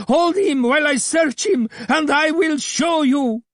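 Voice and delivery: high, loud screech of a hateful man; vulture-like Abdullah screeching; screeching accuser. A narrator's reading. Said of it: "screamed Abdullah".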